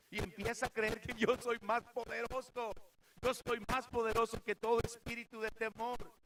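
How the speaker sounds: loud, high, driving pulpit voice; fiery preaching. Acted. Preacher shouting God's command not to fear to the youth. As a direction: thunder it at the congregation.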